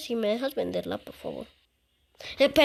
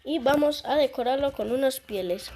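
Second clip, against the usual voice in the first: usual speaking voice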